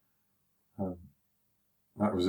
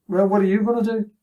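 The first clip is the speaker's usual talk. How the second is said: high, loud taunting dare; goading him to hit first; cocky challenge; 12 semitones higher, 4 dB louder than usual. Retold or imitated. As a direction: throw the challenge back at him. Bloke squaring up for fight.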